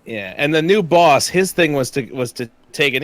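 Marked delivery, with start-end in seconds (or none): none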